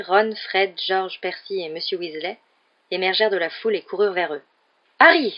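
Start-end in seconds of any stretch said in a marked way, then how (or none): 5.0-5.3 loud, high cry of the name; exclaimed call. A narrator's reading. Mr Weasley runs up to Harry, exclaiming loudly. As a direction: call out on finding a lost boy. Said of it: "s'exclama monsieur Weasley, hors d'haleine".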